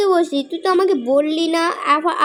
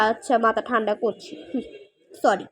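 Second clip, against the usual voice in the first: soft, lower, settling down; calming down; 6 semitones lower, 6 dB quieter than usual. Acted. Rabita, scolded by her friend, calms down softly. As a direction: give in and cool off quietly.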